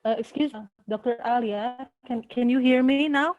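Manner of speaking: straining to be heard on a bad call; bad connection. Real, not acted. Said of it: "I'm sorry for the bad connection".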